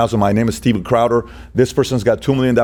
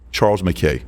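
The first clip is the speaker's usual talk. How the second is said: normal talking voice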